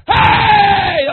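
high, loud acted yell of fear; deep cry out. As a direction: yell it from the lungs. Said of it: "a deep cry that come up out of their lungs".

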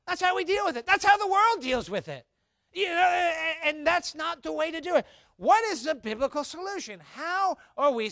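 high, loud preacher's cry; rising to a shout from the pulpit; preaching at full force. Acted. Preacher crying out to the congregation, high and loud.

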